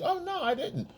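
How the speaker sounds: very high, soft innocent denial; girlfriend voice. Retold or imitated. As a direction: play it sweet and innocent, pitch way up. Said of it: "she goes".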